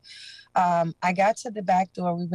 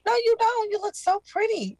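much higher mimicked voice of the woman; high imitation of the woman calling her pretty; voicing the woman. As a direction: pitch way up to quote her flattery. Narrator voices the woman reassuring her.

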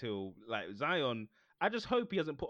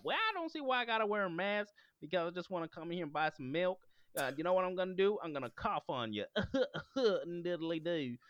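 higher, hammed-up American anti-masker; mock American accent; 5 semitones higher than usual. Anti-mask shopper threatening to cough, mock American accent. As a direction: put on a silly, indignant American accent. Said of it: "I don't know why that's the accent".

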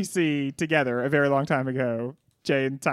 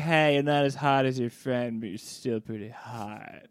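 mock pickup line; 5 semitones lower, 5 dB quieter than usual. Man in crazy hat approaching a lady, mock pickup voice.

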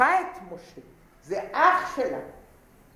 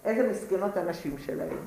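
talking to herself; 4 semitones lower, 6 dB quieter than usual. Retold or imitated. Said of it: "אומרת לעצמה".

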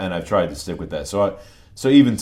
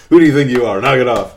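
loud, higher mock scolding through laughter; laughing mock scold; 6 semitones higher, 13 dB louder than usual. [name] playing the barracks kids telling him off, loud and laughing. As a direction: yell it while cracking up.

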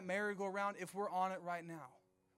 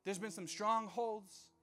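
preaching; 2 semitones higher, 6 dB louder than usual. Acted. Preaches at full force.

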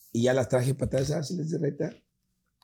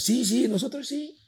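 put-on eager kids; 11 semitones higher, slower than usual. Little siblings eagerly accept ice cream.

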